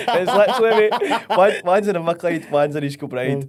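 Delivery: laughing high and loud through the words; words spilling out between laughs. Real, not acted. Laughing with his podcast pal about their matching lives.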